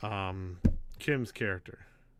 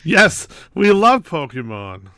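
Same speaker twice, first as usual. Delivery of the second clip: emphatic; 4 semitones higher, 13 dB louder, slower than usual. Real, not acted. Emphatic reply.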